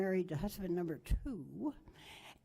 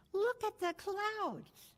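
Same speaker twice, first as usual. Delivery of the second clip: much higher, slow thrilled call; excited yell; 12 semitones higher, slower than usual. Retold or imitated. Eight-year-old calls out to family about the storm, high and thrilled. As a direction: cry it out high, captivated by the sky. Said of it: "he yells out".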